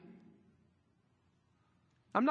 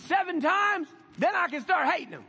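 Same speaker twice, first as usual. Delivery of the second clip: much higher and louder, pushy; brash demand; 10 semitones higher, 6 dB louder than usual. Retold or imitated. Peter bargaining with Jesus about forgiveness, loud and brash. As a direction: blurt it out like an eager know-it-all.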